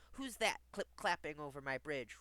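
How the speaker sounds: ordinary delivery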